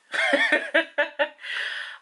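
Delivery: airy, unvoiced laughter; a giddy breathy laugh; breathless laugh. Real, not acted. Laugh after awe.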